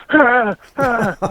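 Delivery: strained grunts through pain; mock pained grunting; imitated pained grunts. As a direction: grunt like a hurt rider kicking the bike. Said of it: "He's like".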